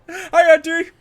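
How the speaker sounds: playful overdone hi; cheeky greeting. Acted. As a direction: greet her like a delighted nephew. Cheerfully greeting villain relative.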